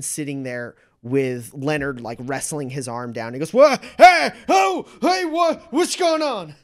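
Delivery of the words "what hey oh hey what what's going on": high, loud startled cries; bewildered man in a panic; panicked confusion. Acted. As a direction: yelp in alarm, much higher than usual. Man waking amid a struggle.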